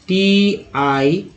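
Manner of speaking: letters drawn out; टी आई spelt letter by letter, very slowly. Shown as a demonstration. Spell out टी आई slowly for students. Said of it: "टी आई ओ एन को बोलते हैं".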